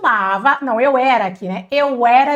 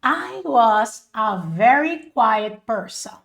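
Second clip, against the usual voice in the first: very slow, evenly spaced English; slow model sentence; slower than usual. Shown as a demonstration. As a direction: say it slowly for learners to follow. Repeats the model for learners.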